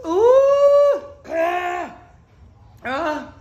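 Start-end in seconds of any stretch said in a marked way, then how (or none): 0.0-1.0 high loud pained wail. Real, not acted. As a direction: wail high as the burn peaks. Burning mouth, crying out.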